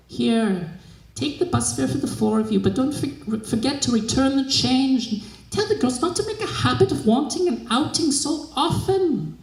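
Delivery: stingy mistress nagging her maid as she gives in; grudging old mistress. Acted. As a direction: hand over the money with a scold. Mistress grudgingly hands over bus fare.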